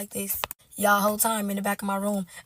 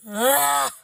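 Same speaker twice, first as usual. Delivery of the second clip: loud, higher-pitched "ugh"; exasperated groan; 5 semitones higher, 6 dB louder than usual. Real, not acted. Woman stressed out over a breakup, exasperated groan. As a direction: groan out the frustration.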